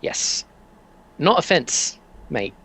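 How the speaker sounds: a hint of a British shopkeeper; British accent. Fence seller correcting the customer in a British accent. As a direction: lean into a London counter-man's accent. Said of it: "can't do this accent very well".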